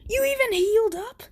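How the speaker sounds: put-on bully can't believe the injured boy walks; high, rushing shocked rant; shocked disbelief. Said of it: "he's like".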